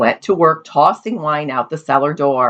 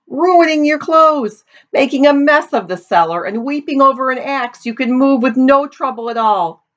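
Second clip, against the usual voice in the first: high-pitched scolding tirade; exasperated scolding; 10 semitones higher than usual. A narrator's reading. Suitor berates the weeping family over wasted wine, exasperated. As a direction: reel off the complaints, pitched right up.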